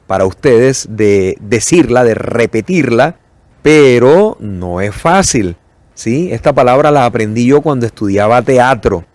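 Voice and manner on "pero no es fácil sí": ordinary delivery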